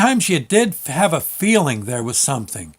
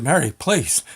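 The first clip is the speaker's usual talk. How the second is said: mild pleading. Retold or imitated. Pleading with ghost in bed.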